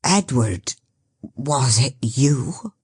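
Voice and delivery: the carrier recognising Edward, low and stunned; low stunned recognition. Acted.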